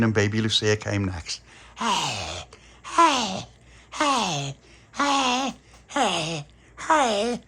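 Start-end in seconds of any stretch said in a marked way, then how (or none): 1.8-7.4 high, crying hey calls; wailing vampire calls. Retold or imitated. Pauline the vampire searching for them, high wailing calls. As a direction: wail each hey up high. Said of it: "like that".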